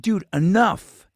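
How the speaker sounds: loud, slow, firm "Dude, enough"; firm warning. Retold or imitated. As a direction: lay down the law, slow and loud. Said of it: "And I said".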